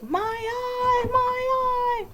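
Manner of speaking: very high-pitched mock wail of pain; imitated child screaming. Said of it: "Like, screaming".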